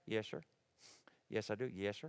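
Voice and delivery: low, soft Alabama "yes, sir"; Alabama good old boy. Retold or imitated. Alabama welder answering questions by the fire, polite Southern accent. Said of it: "he kept saying".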